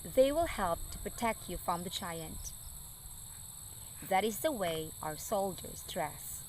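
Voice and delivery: gentle, soft storybook reading; story narration. A narrator's reading. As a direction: read it like a bedtime story for kids. Reading Bible story to children.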